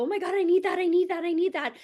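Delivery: high, loud, breathless wanting; mock craving. Coach imitating a social-media shopper, loud high craving. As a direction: gush like a dopamine-hooked shopper. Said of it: "make you go".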